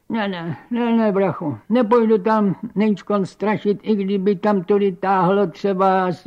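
stubborn refusing spook. Acted.

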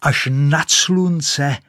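high, loud ecstatic boasting; rising boast. Acted. Rocket boasting to nobody as she flies.

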